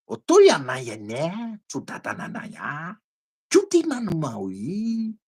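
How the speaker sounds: very slow, fading tongues; speaking in tongues. Retold or imitated. Preacher reenacting tongues for his listening cousin, slow. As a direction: draw out each strange word. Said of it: "eu continuei falando em língua".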